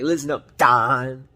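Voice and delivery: loud challenge to a boss; hammed-up bold shout, hardest on the name; mock tough act. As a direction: call him out like a pretend tough guy. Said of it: "putting on his tough acts".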